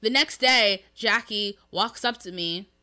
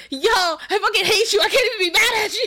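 laughing mock anger; 11 semitones higher, 9 dB louder, faster than usual. [name] laughing yet annoyed.

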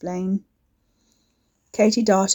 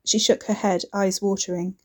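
plain reading voice

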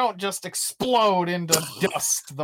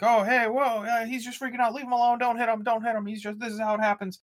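higher, hurried imitation of Richard Pryor; Richard Pryor bit; 8 semitones higher, faster than usual. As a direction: play Pryor trying to calm the crowd. Pryor voiced calming crowd.

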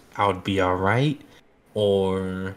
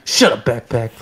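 louder mock scold at backpack; 2 semitones higher, 9 dB louder than usual. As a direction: bark it at the backpack. Scolding backpack to cover noise.

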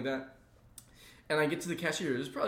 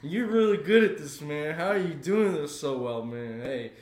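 put-on drunk guy; 3 semitones higher, 5 dB louder than usual. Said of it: "There was this one really drunk dude".

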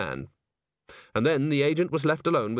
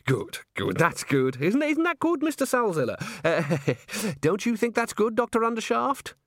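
higher, jittery fake-cheery voice with a laugh; nervous forced cheer; 10 semitones higher than usual. Bucket turns to his colleagues, jittery and forced. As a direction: beg the others to agree.

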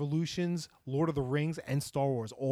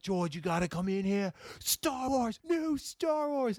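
high-pitched mock scream, drawn out at the end; retold excited scream; 9 semitones higher, slower than usual. Kid calling brother in to see new trailer, retold scream. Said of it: "screaming at the top of my lungs".

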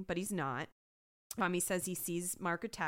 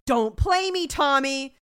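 mock-furious shout, much louder and higher; violent outburst; 6 semitones higher, 13 dB louder, slower than usual. Stabler kicking the table at Tommy, acted angry shout. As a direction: bellow a threat as the tough cop. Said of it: "he kicks the table violently".

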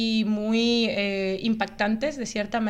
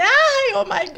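much higher, louder exclamation; overwhelmed exclamation; 11 semitones higher, 6 dB louder, slower than usual. Retold or imitated. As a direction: gasp it out, swamped. Overwhelmed by languages.

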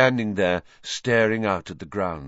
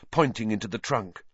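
usual speaking voice

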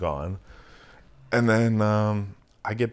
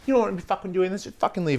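higher, fast snippy imitation; mock drunk girl; 11 semitones higher, faster than usual. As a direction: toss it back like a drunk, indignant girl. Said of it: "she turns around".

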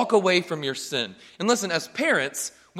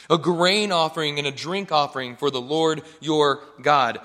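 ordinary talk, nothing marked

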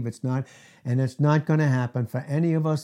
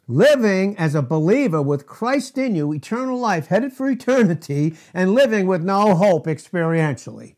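loud, driving preacher cadence; forceful preaching; 6 semitones higher, 6 dB louder than usual. Real, not acted. Preacher driving the point home to his congregation, loud and forceful.

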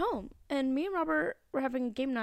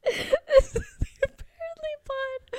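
giggling through the intro line; can't keep a straight face; laughing; 14 semitones higher than usual. Real, not acted. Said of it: "Say with a straight face".

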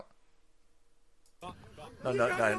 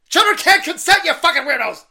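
much higher and louder, yelled at the crowd; furious shout; 12 dB louder than usual. Real, not acted. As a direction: bellow it at them in full fury. Repeats the slogan, yelling at protesters.

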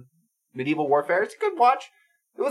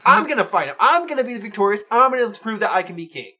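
mock defiant boast; 6 semitones higher, 3 dB louder than usual. Acting out brother's defiant boast.

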